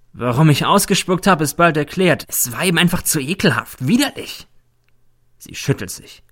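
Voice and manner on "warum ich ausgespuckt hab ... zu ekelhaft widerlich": higher voice, suddenly letting loose; disgusted outburst. Acted. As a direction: burst out in disgust, pitch jumping on the last word. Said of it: "Plötzlich legt sie los". (angewidert losplatzend)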